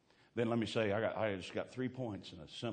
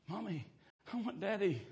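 put-on little girl pleading; 11 semitones higher, 3 dB quieter than usual. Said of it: "She'd go".

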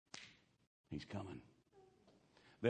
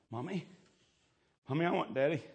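put-on little girl pleading; 7 semitones higher, faster than usual. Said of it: "she started going".

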